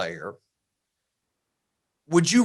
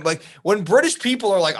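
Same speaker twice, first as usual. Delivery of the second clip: ordinary delivery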